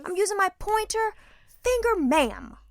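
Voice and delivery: very high, loud exasperation; frustrated. Retold or imitated. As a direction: hammer it home, patience gone. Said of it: "she can see I'm frustrated".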